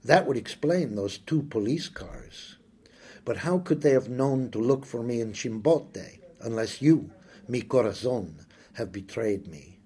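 plain reading voice

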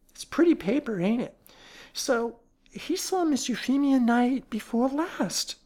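high, anxious chattering servant voice; nervous housekeeper. Acted. Housekeeper rattling on to the detective, high and anxious. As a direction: babble nervously like a frightened charwoman. Voices frightened Mrs Potter.

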